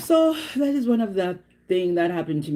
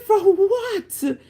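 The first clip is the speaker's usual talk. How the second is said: very high acted disbelief; incredulous retort; 14 semitones higher, 4 dB louder than usual. Driver challenging the officer's ticket, high incredulous retort. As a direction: shoot it back as a high-pitched mock protest.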